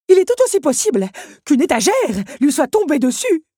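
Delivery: high, loud alarmist voice; dramatic clock. A narrator's reading. Big Ben scaring the others, high and loud. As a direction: paint the worst case.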